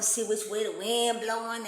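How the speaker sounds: usual speaking voice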